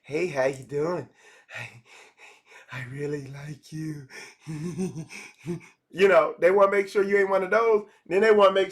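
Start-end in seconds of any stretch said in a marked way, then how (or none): 0.0-4.1 low, creepy come-on, fading quieter; creepy stranger. Retold or imitated. Creepy stranger hitting on a woman, low and fading quiet. 4.5-5.2 soft creepy snicker. Retold or imitated. Creepy stranger snickering softly at a woman.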